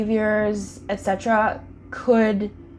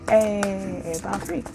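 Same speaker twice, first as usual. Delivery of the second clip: ordinary talk, nothing marked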